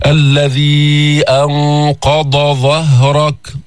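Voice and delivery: very slow chanted verse; Quran recitation. Shown as a demonstration. Teacher reciting an example verse, very slow chant. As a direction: draw out الذي أنقض ظهرك and keep dad and dha apart. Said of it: "مثل".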